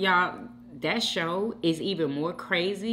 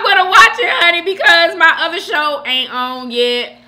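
much higher and louder, playful and emphatic; gleeful confession; 11 semitones higher, 13 dB louder than usual. Real, not acted. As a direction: belt it out like a guilty pleasure. Repeats it, laughing and emphatic.

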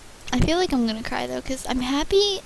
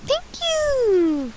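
high, bright burst of thanks; excited thanks; 11 semitones higher than usual. Real, not acted. Gamer thanking a fan, excited high thanks. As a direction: squeal the thank you out.

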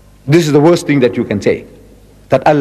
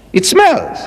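high, mock wrinkled-nose it smells; disgusted; 11 semitones higher than usual. Real, not acted. Preacher mocking the word's new meaning, disgusted. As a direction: sniff out the suspicion.

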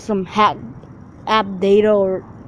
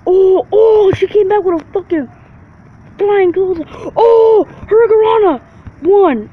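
excited shout; 9 semitones higher, 5 dB louder than usual. Real, not acted. Hype gameplay reaction.

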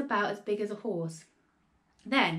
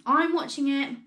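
raised, higher-pitched voice; shouting back; 5 semitones higher, 6 dB louder than usual. A narrator's reading. Grandma shouting back to George, high raised voice. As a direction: call back across a distance. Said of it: "the old girl shouted back".

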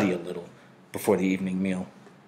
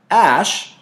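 calls across the yard, higher and louder; calling out; 9 semitones higher, 7 dB louder than usual. A narrator's reading. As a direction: shout a friend's name across the field. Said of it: "he had called".

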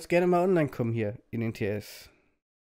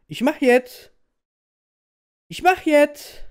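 much higher, louder shout; 14 semitones higher, 12 dB louder, slower than usual. Real, not acted. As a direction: yell it back impatiently. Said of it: "Red nicht zu viel, mach einfach".

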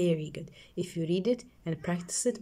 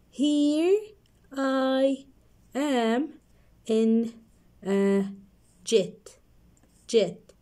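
one word at a time, pauses; 4 semitones higher, much slower than usual. Shown as a demonstration. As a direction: read each word separately. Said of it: "So let's read".

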